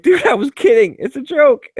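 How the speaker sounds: high, loud, defensive; a coworker backpedalling loudly; protesting laughingly. Retold or imitated. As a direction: insist it was only a joke. Protesting he was joking.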